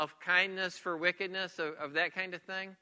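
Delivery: ordinary talk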